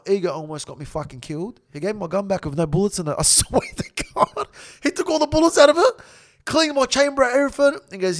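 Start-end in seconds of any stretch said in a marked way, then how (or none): 3.2-7.8 high-pitched laughing amazement. Real, not acted. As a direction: swear it's true through laughter. Laughs telling the bullets were removed.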